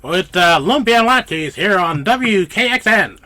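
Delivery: booming fifties radio announcer, high and loud; cheery old-style DJ greeting listeners; old-time radio host. Pretend. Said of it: "my radio person sounds like an old style radio person from, like, the fifties".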